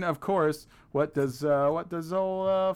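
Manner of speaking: ordinary delivery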